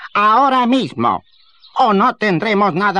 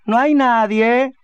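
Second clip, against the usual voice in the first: calling out; 5 semitones higher than usual. Acted. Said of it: "gritó".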